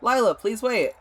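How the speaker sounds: loud, high call after someone running off. Acted. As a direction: shout to stop her. Calling after fleeing Lila.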